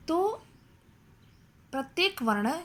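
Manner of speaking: ordinary talk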